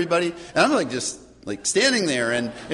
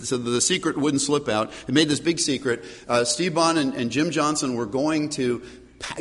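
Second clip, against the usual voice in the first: ordinary delivery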